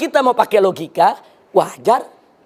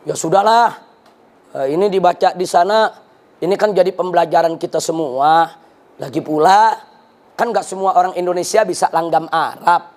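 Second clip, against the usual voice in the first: lightly voiced official, lower than usual; ministry official; 6 semitones lower than usual. Preacher relaying the ministry's excuse, lightly voiced official. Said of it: "ada lagi yang mengatakan begini dari kementerian".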